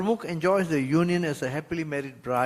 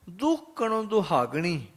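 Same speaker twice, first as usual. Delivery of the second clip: scripture recitation; 4 semitones higher, faster than usual. Acted. Verse repeated.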